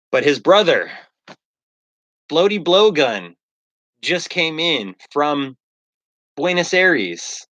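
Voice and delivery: a hint of a host mourning then welcoming; mock solemn announcement. Streamer announcing to followers, lightly hammed-up ceremony. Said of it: "I'm like".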